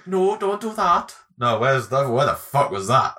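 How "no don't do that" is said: high, slow Welsh sing-song; hammed-up Welsh impression; Welsh accent. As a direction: protest in a drawn-out mock-Welsh lilt. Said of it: "Yeah, that's Wales".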